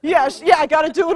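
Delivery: ordinary talk, nothing marked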